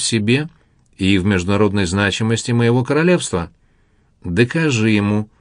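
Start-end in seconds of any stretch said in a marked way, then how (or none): none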